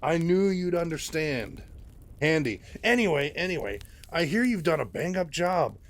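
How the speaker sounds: a slick, self-pleased mentor gushing over his star; oily Handy Anderson. Acted. Handy Anderson voiced in story.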